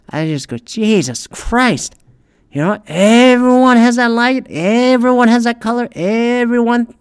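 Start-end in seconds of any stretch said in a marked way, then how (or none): 0.6-1.9 slow drawn-out exasperation; exasperated. Retold or imitated. Exasperated reaction to office videos. 2.5-6.9 high, drawn-out mocking monotone. Real, not acted. Mocking identical home offices.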